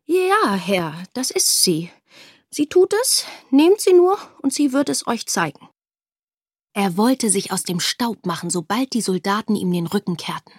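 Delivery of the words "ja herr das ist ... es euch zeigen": hoch und hastig, dann gedehnt „Herr, das ist sie“; eingeschüchterter Vater. Acted. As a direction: der Vater biedert sich ängstlich an. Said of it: "eingeschüchtert von den strengen Blicken". (Vater verrät seine Tochter vor den Soldaten, eifrig)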